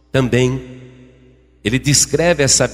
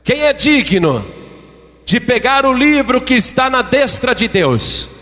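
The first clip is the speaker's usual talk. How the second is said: high, ringing proclamation; angel's cry; 8 semitones higher than usual. Acted. Angel calling heaven for volunteers, crying out. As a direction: cry it out as a challenge to all of heaven. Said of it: "o anjo ficava bradando no céu".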